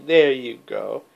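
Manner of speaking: ordinary delivery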